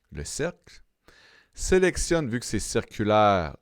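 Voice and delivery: usual speaking voice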